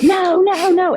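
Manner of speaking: high, frantic pleading no; panicked no. Retold or imitated. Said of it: "I'm in complete panic mode".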